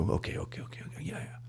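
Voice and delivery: whispered, shrinking okays; nervous whisper. Retold or imitated. Giving in to his partner, whispering nervously. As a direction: breathe the okays out under pressure. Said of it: "I go".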